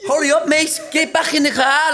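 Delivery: mocking the man hurrying him out; shouty Scouser. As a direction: yell like an impatient car park attendant. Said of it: "like that, yeah?".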